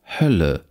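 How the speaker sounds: "Hölle" with a short open ö; short lax vowel. Shown as a demonstration. Lecturer reads minimal pairs, short lax vowel. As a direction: keep the ö in "Hölle" brief.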